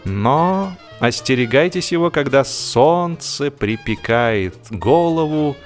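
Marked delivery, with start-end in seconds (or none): none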